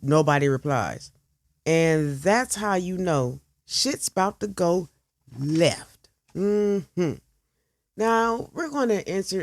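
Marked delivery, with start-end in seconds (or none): none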